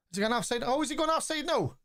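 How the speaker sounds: high-pitched, urgent offside query; anxious match reaction. Real, not acted. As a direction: ask it urgently, pitch climbing well up as the attack unfolds. Watching attack, possible offside.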